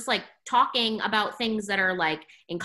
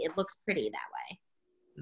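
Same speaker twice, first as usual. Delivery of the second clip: whisper; 6 semitones lower, 9 dB quieter, slower than usual. Retold or imitated.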